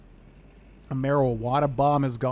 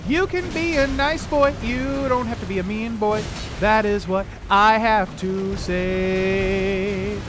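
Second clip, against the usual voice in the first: put-on much higher, slow, sing-song baby talk; scolding a boss like a pet; 7 semitones higher, 4 dB louder, slower than usual. Gamer scolding a bear boss, high baby-talk voice. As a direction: coax the angry bear like a naughty dog.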